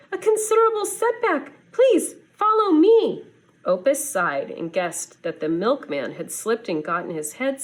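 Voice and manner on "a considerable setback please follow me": high, fast, fussy duck voice; frantic duck. Acted. Snow duck urging Opus out of bed, high and hurried. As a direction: rattle it off high like a panicked bird. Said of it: "the duck hollered".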